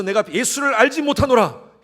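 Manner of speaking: Peter's loud, high denial. Acted. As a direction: shout the denial before Jesus. Peter denying Jesus, voiced. (예수님 앞에서 베드로가 크게 부인하는 외침)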